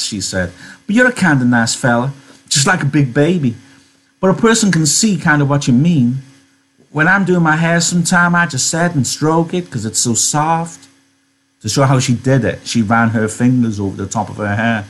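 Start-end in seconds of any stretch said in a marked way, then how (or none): 0.9-10.9 higher, lilting folksy chatter; friendly Curly's wife. Acted. Curly's wife opening up to Lenny, chatty and friendly. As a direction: confide it in an easy, pitched-up voice.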